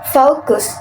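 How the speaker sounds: "focus" said the British way; British-accented "focus"; British pronunciation. Shown as a demonstration. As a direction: give "focus" a British gliding O. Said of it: "In British".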